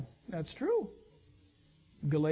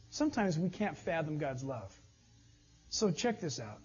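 usual speaking voice

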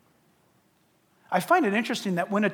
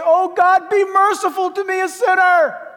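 higher, loud, pleading prayer; desperate cry; 11 semitones higher, 12 dB louder than usual. Acted. Sinner on the street corner crying out, loud and pleading. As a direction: cry out to God for mercy.

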